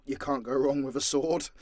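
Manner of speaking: ordinary delivery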